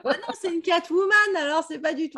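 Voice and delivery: high, laughing protest; amused protest. Real, not acted. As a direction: shoot the idea down with a laugh.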